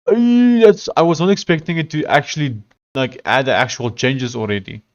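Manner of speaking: a thrilled hey, caught off guard; delighted surprise. Real, not acted. Surprised the feature works.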